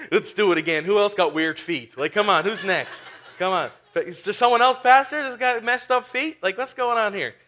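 rally the room, who's next; excited hype. Retold or imitated. Hyping the crowd for more healings.

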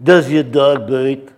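slow, mock French inspector voice; a slow put-on French accent asking about the dog; Clouseau impression. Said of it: "He comes up to the guy and says".

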